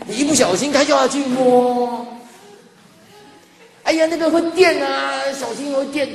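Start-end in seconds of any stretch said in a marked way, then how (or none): 3.8-6.1 mock warning to a child. Warning a child about electricity.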